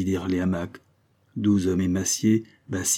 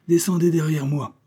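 raised, projected command; high, louder skipper's order; captain's order; 9 semitones higher, 5 dB louder than usual. Acted. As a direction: call the men to follow. Captain orders men to follow.

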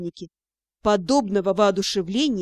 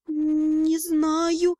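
stammering; 4 semitones higher than usual. A narrator's reading. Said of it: "заикаясь".

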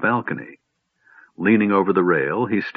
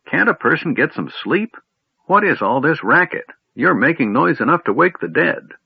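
put-on grumpy old man; 8 semitones higher than usual. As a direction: scold the noisy youngster. Grumpy old man woken by noise.

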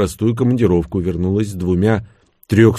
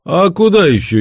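higher, louder surprised question; raised voice, honestly surprised; sincere surprise; 9 semitones higher, 6 dB louder than usual. A narrator's reading. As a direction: ask it with genuine astonishment. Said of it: "искренне удивился Левша".

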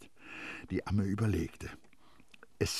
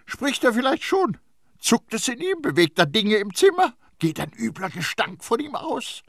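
high-pitched, loud volley of questions; agitated interrogation; incredulous questioning; 7 dB louder than usual. A narrator's reading. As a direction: challenge her with each absurd question. Priest fires challenging questions at nurse.

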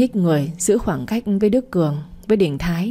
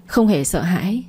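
usual speaking voice

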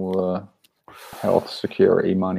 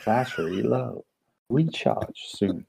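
slow, softer robot battery warning; clipped female robot voice; 2 semitones higher, 6 dB quieter, slower than usual. Retold or imitated. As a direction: mimic an American robot announcement, drawn out. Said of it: "this really clipped female American robot voice".

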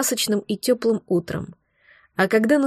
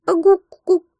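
put-on high, loud baby babble; baby cooing; 9 semitones higher, 5 dB louder than usual. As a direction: coo like a little baby.

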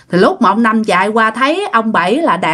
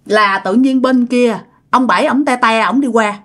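usual speaking voice

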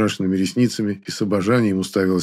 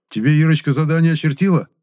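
stern reprimand; 7 semitones higher than usual. A narrator's reading. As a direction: scold him. Zubatov scolding. (строгий выговор)

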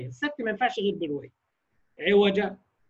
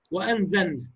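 ordinary delivery

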